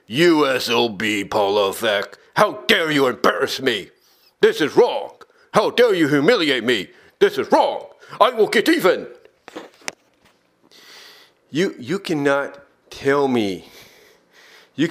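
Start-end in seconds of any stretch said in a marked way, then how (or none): 0.1-9.1 low, seething mock fury; imagined bitter rant. Commentator voicing a rival boss's hidden rage, low and seething. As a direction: growl it like a wounded rival. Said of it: "deep down he's like".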